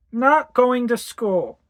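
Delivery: put-on slow, high, defiant little girl; stubborn child voice. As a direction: dig in your heels like a sulky child. Defiant child refusing school.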